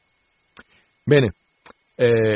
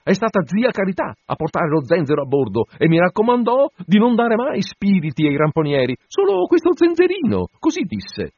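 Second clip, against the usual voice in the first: frightened steward; 9 semitones higher, 4 dB louder than usual. A narrator's reading.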